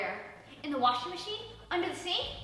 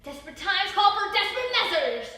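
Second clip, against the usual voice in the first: loud, higher, grand pronouncement; dramatic declaration; 8 semitones higher, 11 dB louder than usual. Acted. Sasha decides on missing flyers, loud dramatic declaration. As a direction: announce it like a heroine. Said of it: "she declared".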